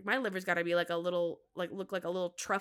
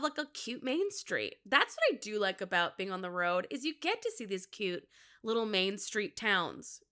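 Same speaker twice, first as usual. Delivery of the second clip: ordinary talk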